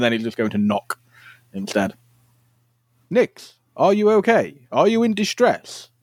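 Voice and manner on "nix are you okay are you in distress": high, slow, carrying call through the door; loud formal call. Acted. Alpha calls through the door to hungover Nix, loud and slow. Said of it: "wish you wouldn't shout so damn loud".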